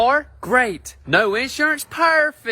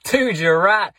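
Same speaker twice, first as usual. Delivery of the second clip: normal talking voice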